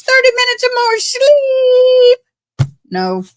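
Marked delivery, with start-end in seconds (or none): 0.0-2.2 much higher, gleeful squeal; excited. Real, not acted. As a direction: squeal with delight. Delight at extra sleep.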